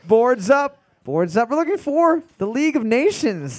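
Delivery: loud host announcement; calling out. Acted. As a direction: call it across the room.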